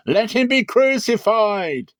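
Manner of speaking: high, loud, slow mob outcry; crying out. A narrator's reading. The crowd crying out to Pilate again, high and loud. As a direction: cry it out as a furious crowd. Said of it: "cried out exceedingly".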